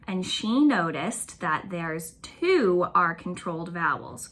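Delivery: ordinary talk, nothing marked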